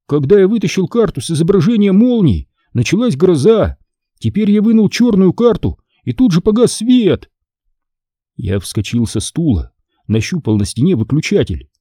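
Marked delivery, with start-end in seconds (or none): 0.1-7.3 put-on high-pitched insistent boy; worried boy arguing. Boy linking cards to storm, alarmed. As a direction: build the case in alarm.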